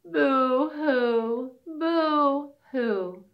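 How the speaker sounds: high pretend boo-hoo sobs; boo-hoo like a weepy fawn; mock crying. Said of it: "started to cry".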